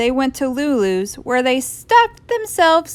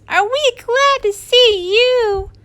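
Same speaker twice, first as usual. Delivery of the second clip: put-on high-pitched, relieved kids; gleeful raised child voice; excited cry; 14 semitones higher, 4 dB louder than usual. A narrator's reading. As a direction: welcome someone back joyfully. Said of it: "cried the kids".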